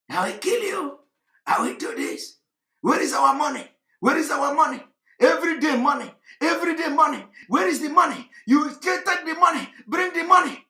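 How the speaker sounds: high-pitched, insistent mimicry of demands; mock ranting. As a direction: imitate activists haranguing for money, each demand punched out. Mimics activists' threats and money demands.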